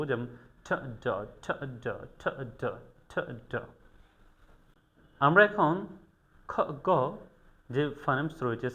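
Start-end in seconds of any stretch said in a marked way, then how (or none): 0.6-3.5 ঠ ড repeated softly; softer home practice of ঠ and ড; quiet practice drill. Shown as a demonstration. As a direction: murmur ঠ, ড over and over to practise. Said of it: "বাসায় বারবার practice করব".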